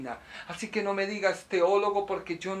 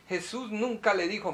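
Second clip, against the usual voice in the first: normal talking voice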